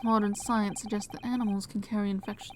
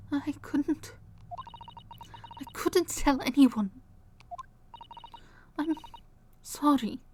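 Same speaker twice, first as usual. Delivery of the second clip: put-on Russian accent; 5 semitones higher than usual. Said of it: "I'm very bad at Russian accent".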